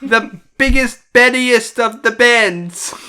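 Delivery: high, slow hammed-up British; silly British kid, drawn out; mock British accent. Topping friend's Big Ben joke in mock British. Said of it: "this British weirdo".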